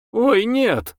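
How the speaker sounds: quick-spirited high protest; lively. A narrator's reading. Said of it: "живо сказала Любаша".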